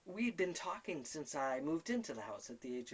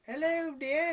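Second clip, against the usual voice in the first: much higher, loud, sing-song greeting; sweet old lady spirit; 13 semitones higher, 11 dB louder, slower than usual. Retold or imitated. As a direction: coo it like a doting old woman. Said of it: "there'd be another one who".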